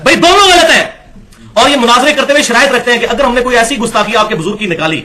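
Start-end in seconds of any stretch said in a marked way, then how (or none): 0.0-3.0 much higher and louder, fed-up verdict; exasperated exclamation. Real, not acted. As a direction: throw up your hands: both are wrong.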